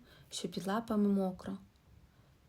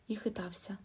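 ordinary delivery

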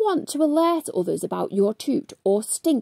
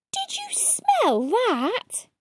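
sly whisper-like question; 8 semitones higher, 4 dB quieter, slower than usual. Acted. Someone sniffing the crowd.

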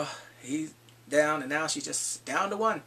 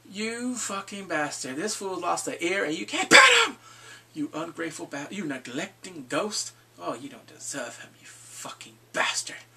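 mock tirade. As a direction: drag out the insults, erupt, then seethe down to whispers. Scolding Jon for leaving his wolf.

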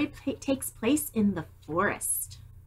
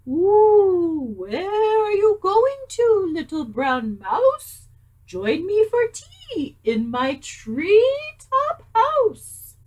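much higher, louder, slow owl voice; hooting owl; 11 semitones higher, 8 dB louder, much slower than usual. Acted. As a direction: coo it like a grand old owl. Owl invites the mouse.

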